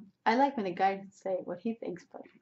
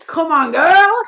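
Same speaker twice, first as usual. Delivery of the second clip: loud, high, hammed-up come-on; mock pushy come-on; 7 semitones higher, 16 dB louder, much slower than usual. Guy behind her on the dance floor, mock come-on. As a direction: holler it like a pushy club guy.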